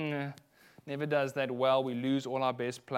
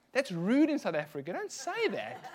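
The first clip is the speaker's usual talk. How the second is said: shocked protest; 6 semitones higher than usual. Retold or imitated. Retold reaction to waitress.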